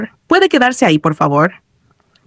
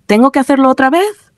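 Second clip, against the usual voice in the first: ordinary delivery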